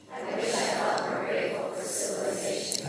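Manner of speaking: breathy whispered repeat. Shown as a demonstration. Teacher repeating it silently to the audience, whispered. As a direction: whisper it to yourself.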